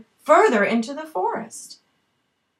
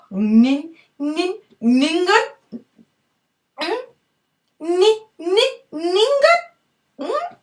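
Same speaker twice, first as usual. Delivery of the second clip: stuttering rising call of her name; teasing sing-song snake call; 7 semitones higher, 4 dB louder, slower than usual. Acted. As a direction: tease her name, rising higher each time. Snake calls Ningun while swallowing.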